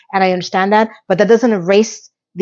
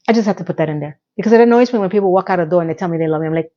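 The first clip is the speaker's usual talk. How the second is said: ordinary talk, nothing marked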